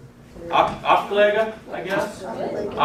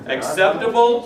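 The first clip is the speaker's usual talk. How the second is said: ordinary delivery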